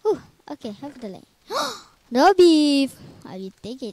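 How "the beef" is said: gasp, then loud and high delight; excited gasp. Real, not acted. Hungry gamer spots beef in the game, loud excited gasp. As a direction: pounce on the find. Finds food item.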